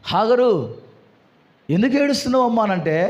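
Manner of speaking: normal talking voice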